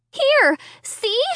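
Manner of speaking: high, urgent pleading; pleading cry. A narrator's reading. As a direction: push them to look. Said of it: "I cried".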